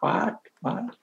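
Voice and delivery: high, puzzled double "what"; baffled "what". Retold or imitated. Imitating an American who can't follow the accent, baffled. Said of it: "it was always like".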